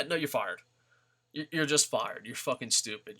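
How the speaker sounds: ordinary delivery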